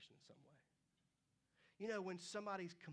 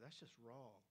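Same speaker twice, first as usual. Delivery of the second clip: muttered aside; 7 semitones lower, 10 dB quieter than usual. Retold or imitated. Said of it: "that you're like".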